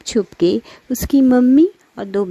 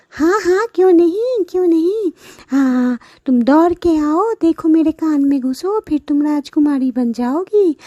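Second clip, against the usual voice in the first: high falsely sweet voice; sly sweet buffalo; 9 semitones higher, 3 dB louder than usual. Acted. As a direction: lure her in sweetly.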